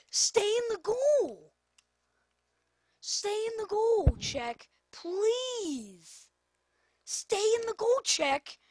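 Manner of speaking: loud, high yell at the goalkeeper; screaming at the keeper. Real, not acted. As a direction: scream the order over and over. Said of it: "I'm screaming at you".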